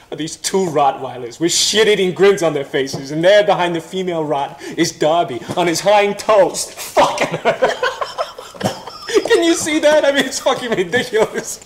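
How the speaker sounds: can't hold back the laugh; laughing while telling. Real, not acted. Cracking up telling his buddy about the dog, laughing.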